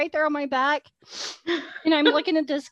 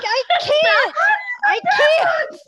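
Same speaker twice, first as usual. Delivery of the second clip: much higher, louder mock cry of panic; panicked shout; 8 dB louder, much slower than usual. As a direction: yell it as if stuck and terrified. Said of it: "I'm like".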